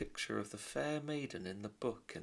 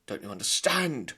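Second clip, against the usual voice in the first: much louder exasperated cry; shouting father; 4 semitones higher, 9 dB louder than usual. Acted. Lester shouting at his wife, exasperated. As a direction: throw it at her in frustration. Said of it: "shouted Lester".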